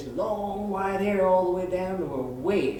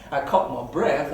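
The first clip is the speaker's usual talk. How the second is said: ordinary talk, nothing marked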